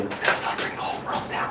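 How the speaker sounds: a whispered grave warning, with This and gonna barely voiced; a hushed whisper, leaning in close. Shown as a demonstration. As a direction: lean in and whisper it like a secret. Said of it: "I tell you".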